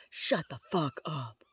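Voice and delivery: slow, emphatic stage whisper; drawn-out whispered retort; forceful whisper. Pretend. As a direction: whisper it slowly and hard. Whisper demo turned rude retort.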